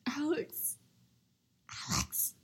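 gasping scandalized "Alex!"; shocked gasp. Real, not acted. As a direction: clutch your pearls. Shocked at crude joke.

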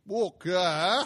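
a loud, disbelieving cry of one word; boy's exclamation. Acted. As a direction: blurt it out in surprise. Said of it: "exclaimed the boy".

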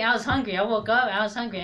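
ordinary talk

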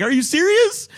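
high, loud incredulous question; retold disbelief. Visitor hears the wood chipper is nearby, acting incredulous. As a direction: leap up in pitch, acting astonished.

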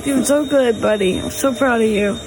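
normal talking voice

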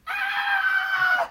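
mock children's shriek, high and loud; kids' scream. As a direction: shriek like frightened first graders. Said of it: "And all the kids would go".

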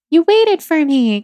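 a burst of grateful disbelief; surprised relief. Retold or imitated. To Chris outside the bathroom, surprised relief.

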